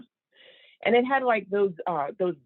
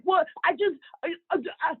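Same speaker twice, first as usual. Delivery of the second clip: higher, stammering mock excuse; flustered dad; 8 semitones higher, faster than usual. Dad caught out by mom, flustered stammering. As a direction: sputter as if caught red-handed.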